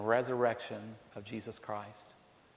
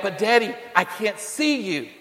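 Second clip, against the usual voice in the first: put-on a child's raised call, somewhat high; boy yelling back; 6 semitones higher, 6 dB louder than usual. Trapped boy calls down to his father, raised and high. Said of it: "the little boy yelled back".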